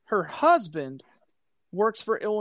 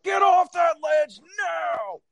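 much higher stern dad shout, slow and loud; dad mode; 11 semitones higher, 4 dB louder, slower than usual. Retold or imitated. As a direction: bark it like a furious father catching kids on a ledge. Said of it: "So I put dad mode on".

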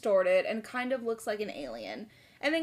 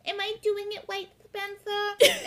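high mock Carly; 8 semitones higher than usual. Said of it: "Carly's kind of like".

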